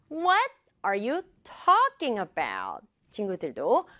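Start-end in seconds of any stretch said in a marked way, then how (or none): 0.1-2.9 What and talking both emphasized; slow line punching What and talking; stress on two words. Shown as a demonstration. Mother questioning child, stressing What and talking. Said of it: "선생님이라면 두 개".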